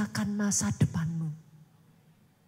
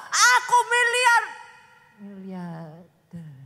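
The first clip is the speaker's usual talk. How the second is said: loud boast fading out; 4 semitones lower, 8 dB louder, much slower than usual. Shown as a demonstration. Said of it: "overblown boast then collapse".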